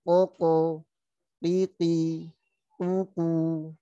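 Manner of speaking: Koko, piti, kuku each with a crisp bouncing stop; qalqalah drill. Shown as a demonstration. Teacher drills the bouncing letters for students, crisp. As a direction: bounce each syllable out clearly. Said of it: "Huruf yang sama, harokat yang sama".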